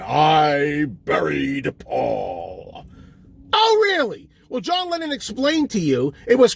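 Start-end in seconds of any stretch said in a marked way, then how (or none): 0.0-2.9 very low, dragged-out ghostly moan; eerie slowed voice. Retold or imitated. Imitating the hidden voice in the song, slow low moan. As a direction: stretch it out low and slow like a warped tape. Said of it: "you hear a voice supposedly saying".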